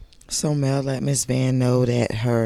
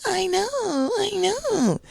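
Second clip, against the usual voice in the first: high squeaky fake agreement; mocking high voice. Mocking Tisha's reply.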